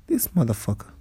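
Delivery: ordinary delivery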